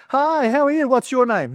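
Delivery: high-pitched, chirpy mock introduction; put-on meet-and-greet voice; cheery greeting. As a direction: greet a stranger in an exaggerated high voice. Acting out meeting someone oddly named.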